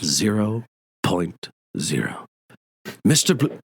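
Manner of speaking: a hint of a stern college dean; Dean Wormer quote. Quoting Dean Wormer from Animal House.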